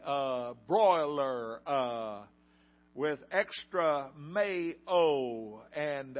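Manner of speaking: each word punched out loud, split by long uh pauses; enunciating in syllables. Retold or imitated. Furious customer enunciates her order to the manager, loud word by word. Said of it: "she enunciates in syllables".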